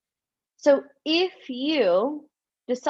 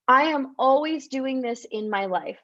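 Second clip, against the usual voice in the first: ordinary delivery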